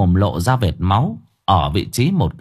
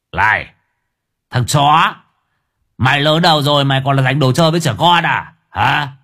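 thug's angry challenge; 3 semitones higher than usual. Acted. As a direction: get in his face. Tattooed father confronts Kiên. (giọng côn đồ quát nạt)

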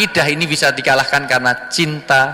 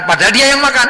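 emphatic aside; 10 semitones higher, 5 dB louder, faster than usual. Real, not acted.

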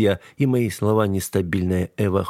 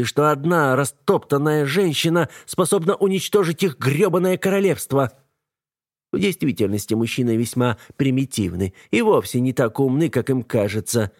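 bitter woman; 6 semitones higher than usual. A narrator's reading. Eva's long tirade.

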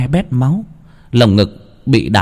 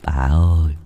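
frightened whisper-ish plea; 5 semitones lower than usual. Acted. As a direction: nudge her, scared, keep it hushed. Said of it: "Ông Tân lại huých vợ".

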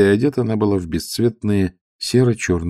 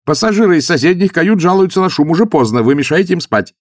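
loud, higher rapid complaint; blurted; 11 semitones higher, 7 dB louder than usual. A narrator's reading. Young officer blurting out complaints to the old man. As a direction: blurt it out all at once. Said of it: "выпалил молодой человек".

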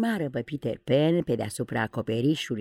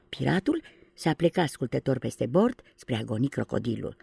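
ordinary delivery

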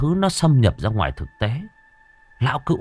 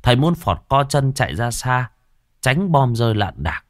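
plain reading voice

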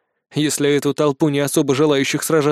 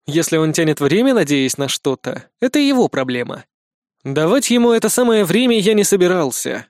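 ordinary delivery, nothing marked

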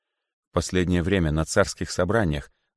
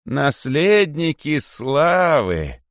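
slow, bitter call; 8 semitones higher, 5 dB louder, much slower than usual. A narrator's reading. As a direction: let the bitterness drag. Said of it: "продолжил он с горечью". (с горечью, протяжно)

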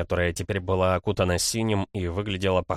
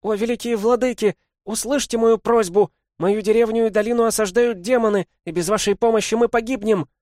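high, loud desperate cry to the lords; a girl begging the great lords for help at the top of her voice; shouting at full voice; 14 semitones higher, 7 dB louder than usual. A narrator's reading. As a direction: call out with all her lungs. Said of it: "прокричала во всё горло".

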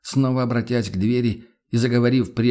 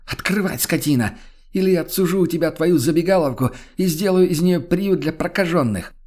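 furious insults; 7 semitones higher than usual. Acted. Woman screaming insults at door.

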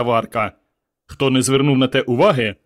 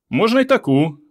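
high, merry call; cheerful call; 8 semitones higher than usual. A narrator's reading. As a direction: toss it off gaily to the crowd. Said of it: "весело гукнув Петро".

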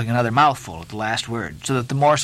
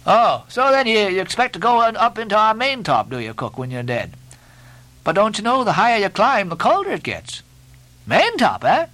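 high, incredulous teasing; Stubb, mocking mate; 10 semitones higher than usual. A narrator's reading. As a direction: ridicule the cook's hope of heaven.

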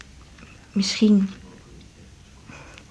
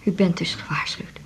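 soft whispered asks; hushed question; 3 dB quieter than usual. Acted. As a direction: whisper it confidentially. Confidential questions to doctor.

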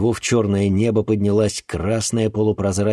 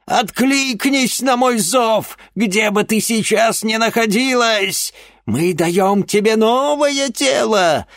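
witch incantation; 5 dB louder than usual. Acted. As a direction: call on dark powers. Witch commands the dead.

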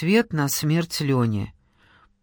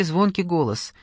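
ordinary delivery, nothing marked

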